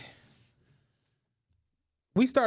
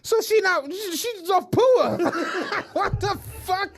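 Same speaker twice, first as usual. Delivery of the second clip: laughing disbelief; 10 semitones higher, slower than usual. Retold or imitated. Mocking the couple's budget to his friend, high laughing disbelief. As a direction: drag it out, cracking up.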